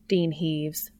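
ordinary delivery, nothing marked

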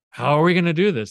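half-hammed alarm from the confused followers; panicked disciples. Disciples panicking at Jesus, mock-alarmed and loud.

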